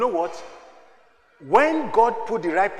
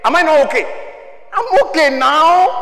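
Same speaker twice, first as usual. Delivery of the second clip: raised voice, stretching the last words; emphatic insistence; 10 semitones higher, 9 dB louder than usual. Acted. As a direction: insist on it with rising volume. Playful insistence that he is okay.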